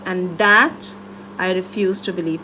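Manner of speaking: "that" lifted, then pause, "I refuse to believe" dropped low; ending with impact. Shown as a demonstration. Coach explains the speech's last line, "that" held before a low close. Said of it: "I wanted to end with a bang".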